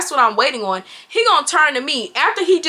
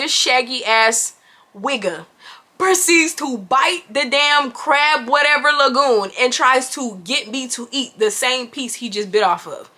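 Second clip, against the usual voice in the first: ordinary talk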